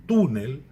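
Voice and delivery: túnel loud on tú, falling away after; stress on penultimate syllable. Shown as a demonstration. Teacher giving an example, "túnel" stressed on tú. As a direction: punch the first syllable of "túnel". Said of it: "que é uma palavra grave".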